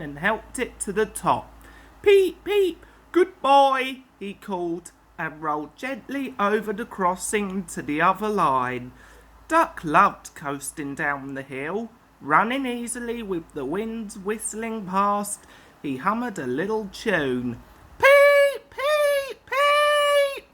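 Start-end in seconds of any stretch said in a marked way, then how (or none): 2.0-4.0 engine whistle call. Acted. 18.0-20.5 engine whistle. Acted. Duck hums whistle tune.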